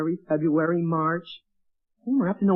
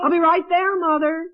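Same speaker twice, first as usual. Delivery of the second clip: calling out; 5 semitones higher than usual. Acted. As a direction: raise the voice to reach mother. Calling to mother in kitchen.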